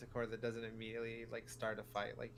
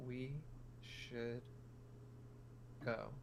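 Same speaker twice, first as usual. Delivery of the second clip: hushed and spaced out, much softer; quiet whisper; 3 semitones lower, 15 dB quieter, much slower than usual. Acted. Player whispers a warning to the party, quietly. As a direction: whisper it slowly, word by word. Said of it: "I'm just gonna quietly whisper".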